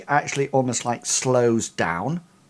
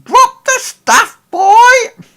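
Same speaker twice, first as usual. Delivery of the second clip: much higher, much louder slow shouted order; calling up; 14 dB louder, much slower than usual. Acted. Old Miko calls up the ladder to Gully, a loud high shout. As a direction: yell it up the ladder, drawn out. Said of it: "she calls up Gully".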